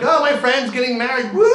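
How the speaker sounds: mock party squeal. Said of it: "And they're screaming out".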